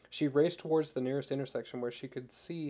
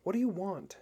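a frightened demand pitched higher; mild fearful challenge; brave but afraid; 6 semitones higher than usual. A narrator's reading. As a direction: try to sound brave while scared. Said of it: "her voice tremulous with fear".

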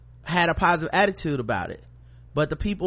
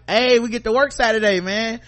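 higher, louder, overly upbeat; mock cheerful; 6 semitones higher, 4 dB louder than usual. To coworkers about Saturday work, mock cheerful. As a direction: sell the Saturday shift with fake cheer. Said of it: "So I would start being like".

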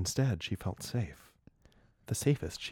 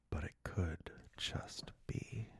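slow breathy whisper, far softer; hushed whisper; 11 dB quieter, slower than usual. Acted. Narrator whispering the dread reveal. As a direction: whisper it like a dark secret.

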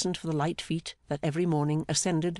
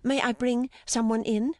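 high-pitched delighted woman's voice; 8 semitones higher than usual. A narrator's reading. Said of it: "said her delighted voice".

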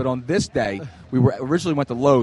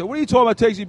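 mock driver's yell, higher pitched; imitated yelling; 7 semitones higher, much faster than usual. Driver yelling from his window at pedestrians, retold as a mock yell. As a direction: heckle like an annoyed driver. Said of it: "starts yelling".

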